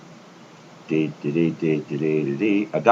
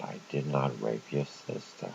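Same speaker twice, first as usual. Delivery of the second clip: very polite; 2 semitones lower, 11 dB quieter than usual. Retold or imitated. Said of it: "in a very polite tone".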